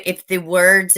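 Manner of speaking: usual speaking voice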